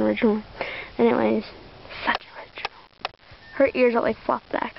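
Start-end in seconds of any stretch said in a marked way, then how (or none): 2.1-2.7 whispered aside. Real, not acted. Sarcastic self-remark.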